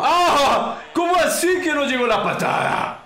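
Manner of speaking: can't believe the kick missed; surprised outcry. Real, not acted. His kick fails to land.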